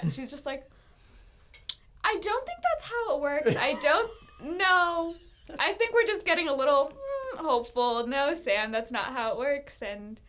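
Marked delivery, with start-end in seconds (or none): 2.0-9.8 higher, louder mock-motherly disbelief; imitated skeptical mom. Mom answering daughter's marriage news, exaggerated skeptical imitation. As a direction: let a daughter down with hammed-up doubt. Acts out her doubtful mother.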